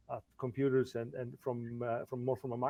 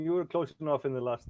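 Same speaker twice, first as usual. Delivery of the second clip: usual speaking voice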